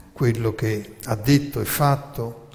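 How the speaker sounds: ordinary talk, nothing marked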